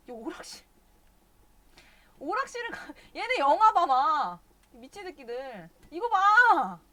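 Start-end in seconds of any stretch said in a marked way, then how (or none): 2.2-4.4 high, loud exasperated yelling at the game. Real, not acted. Streamer exasperated at game characters. 5.9-6.8 high, loud disbelieving shout; shouting look. Real, not acted. Streamer shows absurd game scene.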